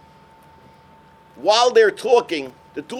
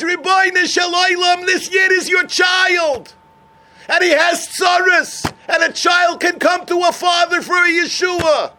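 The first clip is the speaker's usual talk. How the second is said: fervent prayer; 10 semitones higher, 4 dB louder than usual. Retold or imitated. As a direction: cry out to God for him. Baba Sali prays for the Yid.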